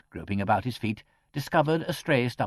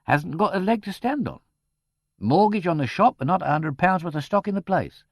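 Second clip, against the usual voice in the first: blunt but kindly; 9 semitones higher, 5 dB louder than usual. A narrator's reading. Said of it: "said Mister McBride bluntly, but not altogether unsympathetically".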